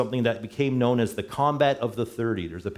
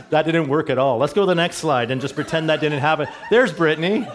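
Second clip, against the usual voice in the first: laughing, louder and higher, thrown by a flop; real laughing; 6 semitones higher, 5 dB louder than usual. Real, not acted. Preacher to congregation after the slide joke flopped, laughing. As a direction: laugh your way through a failed gag.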